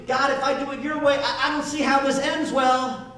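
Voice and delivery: high, pleading worried voice; a hint of a fretful believer; whining to God. Believer questioning God, fretful and pleading.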